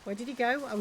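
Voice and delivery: normal talking voice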